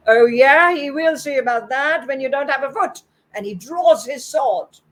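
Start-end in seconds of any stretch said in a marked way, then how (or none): 0.1-3.0 loud, higher-pitched menacing samurai; blustering, outraged comeback; angry threat. A narrator's reading. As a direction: threaten with a bullying blast.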